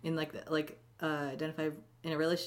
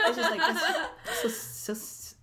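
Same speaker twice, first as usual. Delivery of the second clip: laughing; 12 semitones higher, slower than usual. Real, not acted. Laughing while speaking.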